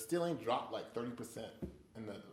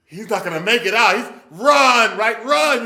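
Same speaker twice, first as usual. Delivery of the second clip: louder, higher urgent yell; urging shout at screen; 6 semitones higher, 14 dB louder than usual. Retold or imitated. Moviegoer yelling at the screen to the hero, loud urgent. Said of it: "gripping your seat like".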